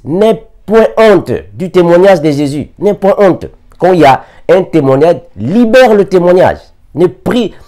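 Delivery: high, loud exhortation; preaching. Acted. Preacher exhorting the congregation to testify, loud and high. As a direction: urge the flock to testify.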